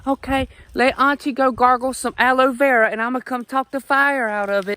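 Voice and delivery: high, fussing southern auntie; sweet old auntie. Retold or imitated. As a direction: coo at a hurt child like a root-lady aunt. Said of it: "I couldn't even ima".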